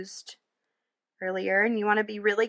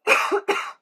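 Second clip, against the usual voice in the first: sharp throat-clearing cough; a short sudden dry cough; 12 semitones higher, 7 dB louder than usual. Real, not acted. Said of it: "I got a frog in my throat".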